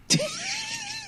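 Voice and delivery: a high-pitched burst of real laughter; high squealing laugh. Real, not acted. Teller cracks up recalling the woman dropping her towel, laughing. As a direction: break up laughing at the memory.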